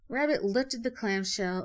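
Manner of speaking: ordinary delivery, nothing marked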